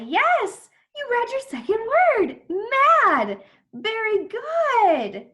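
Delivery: high, loud cheer with stretched words; excited praise. Pretend. Teacher praises child's second word, excited cheer. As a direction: celebrate it like a big win.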